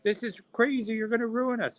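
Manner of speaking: high-pitched mock panic and outrage; outraged retailers. Retailers to the man cancelling their books, mock outrage. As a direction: protest in a high, hammed-up voice.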